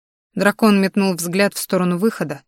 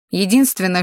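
usual speaking voice

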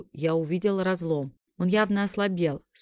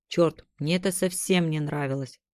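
plain reading voice

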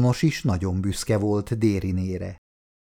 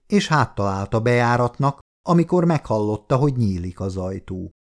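usual speaking voice

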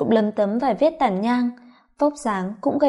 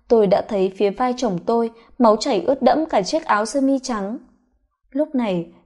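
plain reading voice

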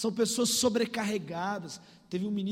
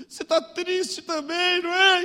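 tearful; 8 semitones higher, 7 dB louder than usual. Retold or imitated. Crying girl asks a praying stranger, high imitated wail. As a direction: wail the question as if in tears. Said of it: "falou chorando comigo".

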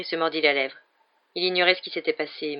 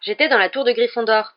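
protesting; 6 semitones higher, 8 dB louder than usual. A narrator's reading. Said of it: "protesta Harry".

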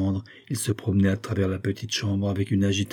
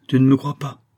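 raised, incredulous question; exclamation; 5 semitones higher, 5 dB louder than usual. A narrator's reading. Friend seeing Jacques shrug, incredulous exclamation. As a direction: exclaim in disbelief, a bit louder. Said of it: "s'écria-t-il".

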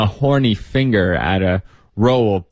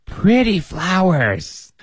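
high, slow, doting insistence; insistent old woman; 8 semitones higher, slower than usual. Acted. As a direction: keep pressing it like a fond granny. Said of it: "the first woman insisted".

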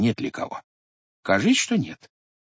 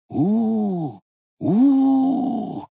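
fearful moan; 10 semitones higher than usual. Acted. Horror at the monstrous face.

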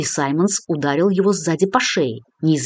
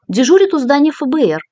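man down shout; 9 semitones higher, 6 dB louder than usual. A narrator's reading. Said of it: "выдохнул раненый".

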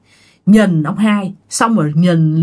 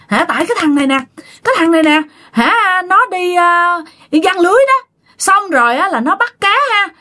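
high, plaintive spirit voice; possessed man complaining; 8 semitones higher than usual. Retold or imitated. Possessed man blames the fellow who fishes, high complaining voice. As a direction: complain in a raised, aggrieved pitch. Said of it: "Anh Tân mới nói rằng á là".

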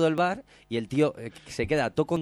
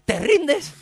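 very high, much louder screamed challenge; screaming; 12 dB louder than usual. Retold or imitated. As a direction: scream it after him as he walks away. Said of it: "gritándole por las calles". (grito agudo y muy fuerte)

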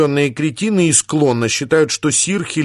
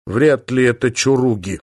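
ordinary delivery

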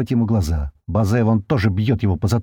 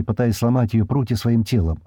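usual speaking voice

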